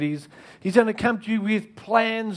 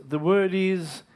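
normal talking voice

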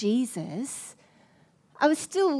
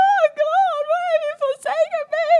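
much higher, louder hammed-up wail to God; mock anguished cry; 7 dB louder than usual. She cries out to God after being skipped, hammed-up and high. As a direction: ham up a dramatic lament.

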